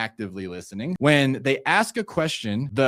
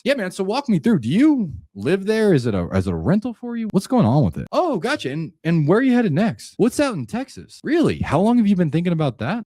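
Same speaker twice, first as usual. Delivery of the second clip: eager, higher, genuinely interested questions; curious tone; 6 semitones higher than usual. Shown as a demonstration. Coach demos the right way to question a seller, higher curious tone. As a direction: lean in as if every answer fascinates you. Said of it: "I'm gonna use a curious tone".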